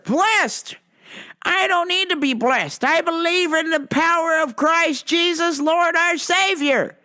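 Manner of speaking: mock zealot lecturing a cashier; preachy Rome guy. Man rejects blessing.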